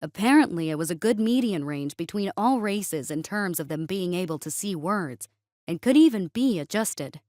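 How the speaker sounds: ordinary delivery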